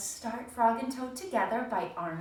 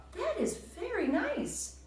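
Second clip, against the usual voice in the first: much higher, slow and sweet; admiring Frog; 13 semitones higher, slower than usual. Acted. Teacher voicing Frog admiring Toad's list, high and slow. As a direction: coo over it.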